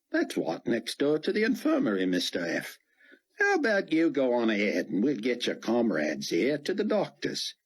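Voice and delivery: casual stranger talking the commander into leaving; lightly voiced folksy helper. Dart to Fortunato, a lightly voiced casual helper.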